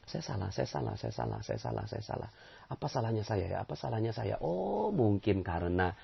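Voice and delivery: soft, low self-blame; hushed, humble muttering to oneself; introspective murmur. Acted. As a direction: murmur to yourself, searching for your fault. Acts out quiet introspection.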